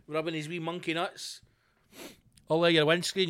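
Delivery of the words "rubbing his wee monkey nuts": ordinary talk, nothing marked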